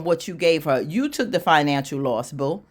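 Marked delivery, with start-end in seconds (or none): none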